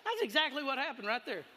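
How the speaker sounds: loud, high preaching at full force; fired-up preaching. Acted. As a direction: preach it like a revival.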